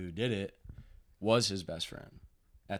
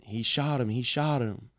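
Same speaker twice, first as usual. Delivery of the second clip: louder, higher imitation of a yell; retold yelling; 5 semitones higher, 6 dB louder than usual. Stepmom running to stepson with news, retold yell. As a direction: imitate her frantic yell, held back. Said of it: "she's yelling".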